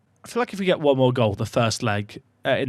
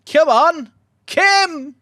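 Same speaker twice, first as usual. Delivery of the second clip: high, loud cheering shout; yelling encouragement; 14 semitones higher, 5 dB louder, much slower than usual. Real, not acted. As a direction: scream his name to urge him on. Urging his player on.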